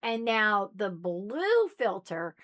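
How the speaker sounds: ordinary talk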